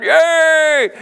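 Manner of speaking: a loud high "Yay!" like a cheering troop; cheering crowd. Acted. Troops cheering for the king, loud and excited. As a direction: cheer it like the soldiers.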